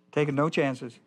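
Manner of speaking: ordinary talk, nothing marked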